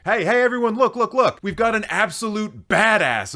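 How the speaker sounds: high, loud mock excitement; rally a crowd to come and gawk, sarcastically; mock excited calling out. Calling everyone to see a boastful reviewer, mock excited.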